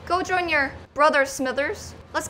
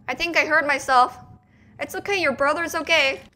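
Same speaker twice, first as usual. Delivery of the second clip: ordinary talk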